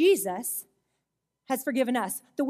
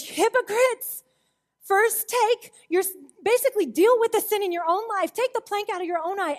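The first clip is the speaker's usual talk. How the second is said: emphatic rebuke; 7 semitones higher, 3 dB louder than usual. Retold or imitated. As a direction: thunder the rebuke from the pulpit. Preacher voices Jesus' rebuke.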